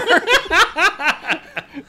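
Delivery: loud high-pitched laugh; high laughter. Real, not acted. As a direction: burst into laughter.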